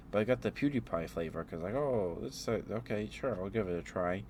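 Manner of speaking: ordinary talk, nothing marked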